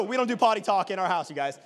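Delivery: normal talking voice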